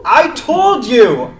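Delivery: insist, fed up with being asked; drawn-out exasperated outburst. Acted. Rabbit exasperated by repeated hoots.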